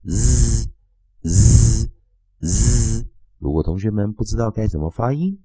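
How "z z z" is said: Z repeated loud; Z sound demo. Shown as a demonstration. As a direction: model Z for students to copy. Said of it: "都是念".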